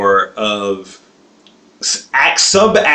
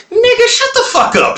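much higher mock voice, "shut the fuck up" cartoonish; retold threat. Imitating the family's threat to the co-host, much higher. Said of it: "their threats are the same".

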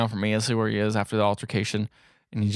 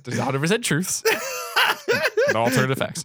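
amused, laughing; 11 semitones higher than usual. Real, not acted. Storyteller joins in the laughing.

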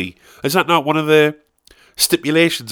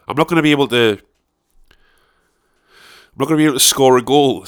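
ordinary talk

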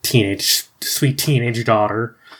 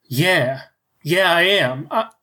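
rising excitement; 6 semitones higher than usual. Acted. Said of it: "Carol is hearing this and is like".

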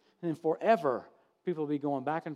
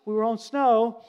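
protesting denial; 4 semitones higher, 6 dB louder than usual. Retold or imitated. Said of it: "I said".

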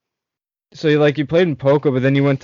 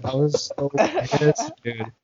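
ordinary delivery

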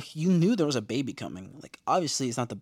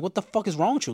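higher-pitched appalled question; 7 semitones higher, 4 dB louder, faster than usual. Retold or imitated. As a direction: scold in mock disbelief. Retold scandalized reaction to character.

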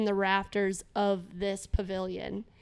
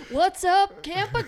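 stand-up comic; 9 semitones higher, 4 dB louder than usual. Pretend. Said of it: "stand-up opener".

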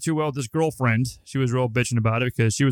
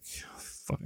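whispered, almost silent curse; a whispered curse when the lie falls apart; under-breath curse; 18 dB quieter than usual. Retold or imitated. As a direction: mouth the swear to yourself. Cursing to himself in head.

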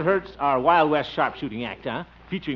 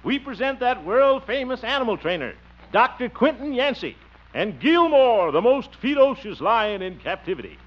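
circus announcer; 7 semitones higher than usual. Pretend. Ringmaster introduces the lion act.

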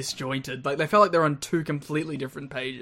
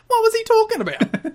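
squeaky, puzzled, laughing; high, bewildered question through a laugh; confused high voice; 6 dB louder than usual. Retold or imitated. Voicing a confused wrestler.

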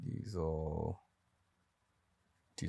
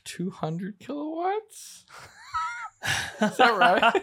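laughing disbelief; much slower than usual. Real, not acted. Laughing disbelief at own figure.

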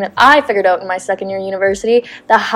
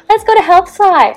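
imitated roommate, pitched up high; roommate's invitation; 8 semitones higher than usual. Roommate's eager invitation acted.

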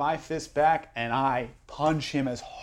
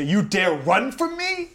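slow, high, loud threat; menacing challenge; 9 semitones higher, 7 dB louder, slower than usual. Acted. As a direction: bear down on the fleeing man. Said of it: "and I say".

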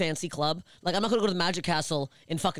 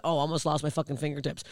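ordinary talk, nothing marked

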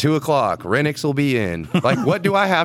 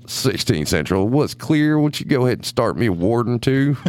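police radio voice. Retold or imitated. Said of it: "he had a radio voice".

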